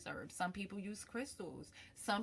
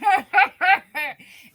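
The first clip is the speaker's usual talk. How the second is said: loud laugh; 14 semitones higher, 25 dB louder than usual. Real, not acted. Laughs after voicing grandmother.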